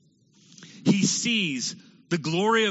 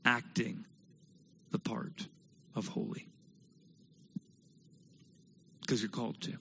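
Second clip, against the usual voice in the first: whispered, much softer, pointed question; hushed whisper; 6 semitones lower, 12 dB quieter than usual. Real, not acted. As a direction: drop to a whisper to let it sink in.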